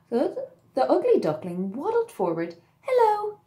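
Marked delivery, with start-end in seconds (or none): none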